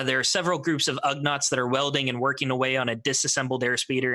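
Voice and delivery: usual speaking voice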